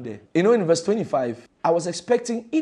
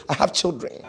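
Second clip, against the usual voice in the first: normal talking voice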